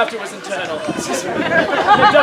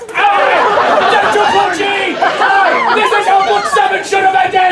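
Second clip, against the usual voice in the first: high, hammed-up battle cries; killing shout; 7 semitones higher, slower than usual. Slaughtering the innocent McDuffs, hammed-up high shouting. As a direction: shout mock death threats high and full.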